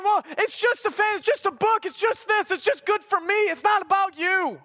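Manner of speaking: high-pitched imitation of someone making excuses; lightly mocking, high protest. Voicing parents' excuses to congregation.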